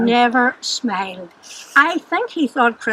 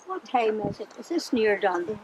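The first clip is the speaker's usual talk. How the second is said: much softer, hushed question to a neighbour; whispered question; 4 semitones lower, 10 dB quieter than usual. Retold or imitated. Girl bored in class asks her neighbour the time, hushed. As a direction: keep it down so the teacher won't hear. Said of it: "I said to the girl beside me".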